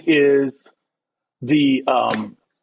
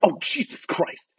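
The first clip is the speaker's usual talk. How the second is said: hushed, whispered dismay; soft voiceless aside, imitating an appalled onlooker; imitated female bystander; 2 semitones higher, 6 dB quieter than usual. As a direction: mimic a woman's whispered exasperation. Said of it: "you hear a female voice going".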